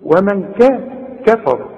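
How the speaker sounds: «ومن كا» مقطعة بكاف رقيقة; توقف عند «كا» ثم انطق «كفر»; ترقيق الكاف. Shown as a demonstration. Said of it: "شوف". («كفر» بكاف مرققة وراء مفخمة)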